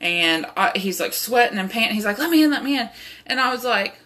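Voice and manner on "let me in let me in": raised, pleading let me in, higher and louder; a man covered in blood begs her to open up; frantic stranger. Retold or imitated. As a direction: beg to be let in like a panting stranger. Bloodied stranger begging at door.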